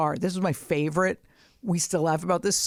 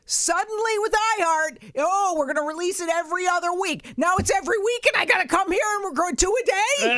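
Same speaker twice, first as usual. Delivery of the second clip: heated rant; 11 semitones higher than usual. Real, not acted. Ranting at the network about the recording schedule, exasperated. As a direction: vent about the schedule, building up.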